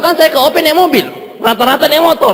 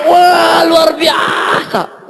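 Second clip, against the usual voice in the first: amazed praise; 6 semitones higher, much slower than usual. Retold or imitated. People cheering the new recruit, drawn-out awed shout. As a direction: stretch it like the whole room is stunned.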